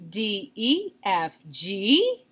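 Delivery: letters asked, "G" swooping up; querying read with a rise on "G"; question intonation. Shown as a demonstration. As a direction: lift "E" and "G" like questions. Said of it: "a question mark".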